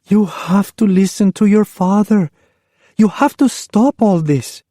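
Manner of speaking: high, urging mother's voice; mother urging daughter to obey; pleading mother. Acted. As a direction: press her gently but firmly.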